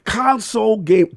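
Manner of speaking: high, loud excited shouting; emotional shout. Real, not acted. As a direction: yell it like the glory days. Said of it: "'cause I'm getting emotional".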